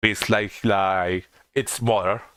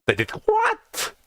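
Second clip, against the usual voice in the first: shocked; much slower than usual. Acted. Character reacting in shock.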